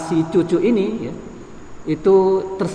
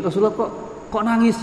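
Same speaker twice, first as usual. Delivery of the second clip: higher, mildly bewildered query; lightly acted astonishment; puzzled question; 6 semitones higher, 3 dB quieter than usual. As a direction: ask it in gentle disbelief. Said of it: "karena ungkapan keheranan ya".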